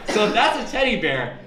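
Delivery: ordinary talk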